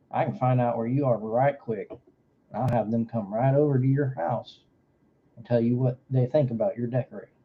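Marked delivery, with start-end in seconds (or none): none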